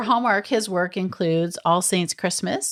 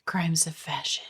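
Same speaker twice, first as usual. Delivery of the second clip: mock-suspense near-whisper; hushed teaser; 7 dB quieter than usual. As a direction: echo the title softly like a mystery teaser. Echoing titles as a dramatic teaser.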